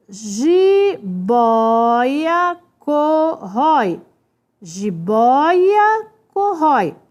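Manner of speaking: "jiboia corrói" slowly stretched into separate syllables. Shown as a demonstration. Teacher sounding out examples for students, each syllable stretched. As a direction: draw out ji, boia, co, rrói.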